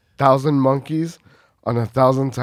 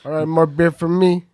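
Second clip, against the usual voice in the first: a loud, higher imitation of a buddy; Godzilla impression; 5 semitones higher, 6 dB louder than usual. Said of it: "The impression was bad".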